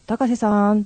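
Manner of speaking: imitated call into a house. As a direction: call out for someone inside, drawn out. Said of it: "呼びかけたら".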